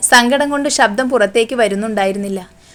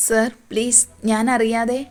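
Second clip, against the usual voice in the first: softer, frightened pleading; frightened plea; 6 dB quieter, slower than usual. A narrator's reading. Student Ammu pleads with her angry teacher, softer and frightened. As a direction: beg him timidly, barely getting it out. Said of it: "പേടിച്ചുകൊണ്ട് Ammu പറഞ്ഞൊപ്പിച്ചു".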